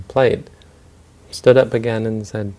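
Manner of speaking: ordinary delivery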